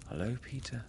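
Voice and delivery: soft, breathy-weak greeting; faint reply. A narrator's reading. Wendy huddled by the fire answers Peter, faintly. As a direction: answer faintly, barely daring to speak. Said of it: "she replied faintly".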